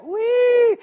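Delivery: loud, high gleeful squeal; toy camel voice. Acted. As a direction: squeal in mock childlike delight. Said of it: "Look at a camel".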